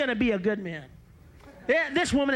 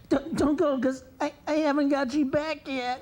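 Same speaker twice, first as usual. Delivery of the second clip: mild tearful plea turning sinister; pleading then vengeful; 4 semitones higher than usual. Retold or imitated. Girlfriend pleading while plotting revenge, lightly voiced. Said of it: "when she was crying".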